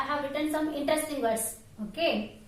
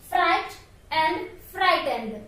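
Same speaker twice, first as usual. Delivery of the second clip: Fright and frightened said very slowly, word by word; slow contrast; much slower than usual. Shown as a demonstration. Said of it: "you have known this word".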